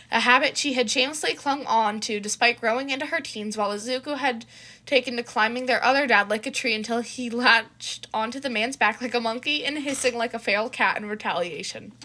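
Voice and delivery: ordinary delivery, nothing marked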